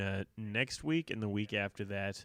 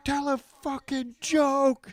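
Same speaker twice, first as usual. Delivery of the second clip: slow, high, loud mock yell; imitated yelling; 8 dB louder, slower than usual. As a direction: yell it like a comic ordering a heckler around. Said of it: "he's, like, yelling at him".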